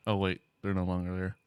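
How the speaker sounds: normal talking voice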